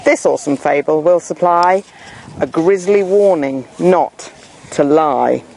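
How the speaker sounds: plain reading voice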